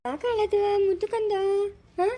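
tender, high-pitched fussing over a little one; baby-talk cooing. Acted. Parent asks the crying darling why, cooing.

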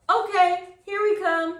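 high, loud cheerful reply; excited child calling back. A narrator's reading. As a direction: shout back down to mother. Answering mother's call.

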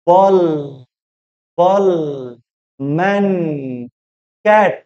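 বল, ম্যান, ক্যাট each in a single beat; one-syllable demo. Shown as a demonstration. Teacher showing monosyllables, বল, ম্যান, ক্যাট each in one beat. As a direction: say বল, ম্যান, ক্যাট as isolated single syllables, long pauses between. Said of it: "একবারই, একবারই, আহ, উচ্চারিত".

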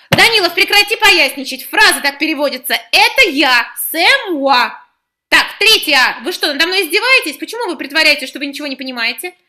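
strained, loud and high scolding; rising exasperation at the class; tense ringing voice. Acted. Teacher scolds the class, voice tense and ringing. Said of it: "Её голос напряжённо зазвенел".

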